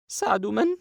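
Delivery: put-on a shaken girl blurting it out, high-pitched; frightened young girl. Sara voiced by narrator. (صوت فتاة خائفة بنبرة عالية)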